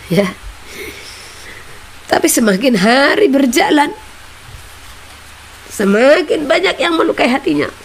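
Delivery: rally the congregation; preacher pleading with the flock, rising fervor; impassioned preaching. Acted.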